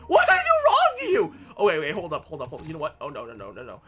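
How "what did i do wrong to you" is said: very high-pitched, loud mock wail. Real, not acted. Gamer squealing at the angry pigmen. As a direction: squeal the question.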